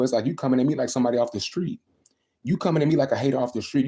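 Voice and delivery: ordinary talk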